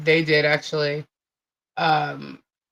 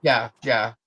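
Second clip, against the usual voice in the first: normal talking voice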